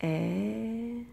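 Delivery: "Et" as one long open è vowel; isolated sound. Shown as a demonstration. Teacher counting sounds for pupils, sustained "Et". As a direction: hold the vowel of "Et" on its own. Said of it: "On entend un premier rond pour".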